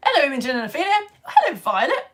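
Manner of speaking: too-high voice. Real, not acted. Greeting viewers by name, voice creeping too high. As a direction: let the hello climb too high. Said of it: "my voice is getting too high".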